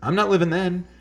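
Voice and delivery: loud high outraged repeat; mock outrage, voice shooting up; indignant. Real, not acted. Indignant retort about canned soda.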